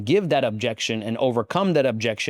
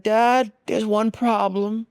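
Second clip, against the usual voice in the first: worried son; 9 semitones higher, slower than usual. Retold or imitated. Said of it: "And I said".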